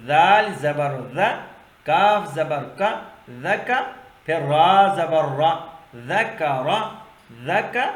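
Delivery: measured primer chant with lifted syllable endings; spelling-out drill. Shown as a demonstration. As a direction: model each syllable for pupils, pausing after it. (قاعدے کے انداز میں لے کے ساتھ ہجے)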